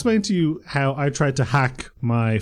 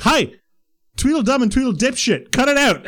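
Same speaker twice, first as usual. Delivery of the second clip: higher, louder mock teacher yell; retold yell; 9 semitones higher, 3 dB louder than usual. Angry teacher yelling at chatting pupils, mock yell. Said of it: "he'd yell".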